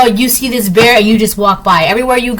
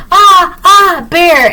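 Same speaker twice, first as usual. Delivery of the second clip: mock scream; 8 semitones higher, 5 dB louder, much slower than usual. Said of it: "relies on people".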